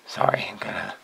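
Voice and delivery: whispered apology under the breath; whispered aside. Real, not acted. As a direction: whisper it off mic. Aside while adjusting something.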